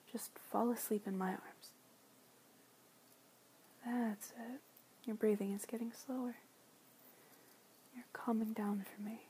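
usual speaking voice

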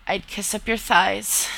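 ordinary delivery

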